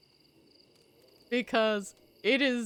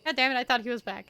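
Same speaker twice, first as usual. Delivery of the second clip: startled outburst; 3 semitones higher, much faster than usual. Real, not acted. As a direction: blurt it out after a fright. Startled by jump scare.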